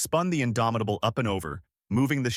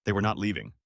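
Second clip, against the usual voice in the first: ordinary delivery, nothing marked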